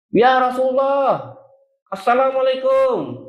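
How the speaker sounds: Umar calls out and begs him to open; calling at the door. Acted. (memanggil kuat dan perlahan)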